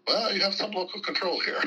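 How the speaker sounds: high, mocking aside in a put-on voice; wry high mock quote; mock caveat voice. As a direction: lightly send up the state's loophole. Mock voicing the state's caveat.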